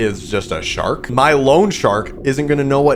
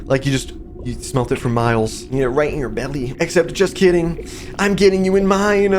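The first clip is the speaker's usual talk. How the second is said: coaxing creature; 3 semitones higher than usual. Acted. Creature luring the player with a delicious eye, teasing. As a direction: coax like a playful creature, then turn the tease on them.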